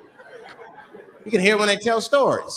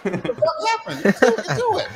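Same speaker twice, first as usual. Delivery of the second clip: rambling woman voice; 8 semitones higher than usual. Acted. Voicing woman telling story.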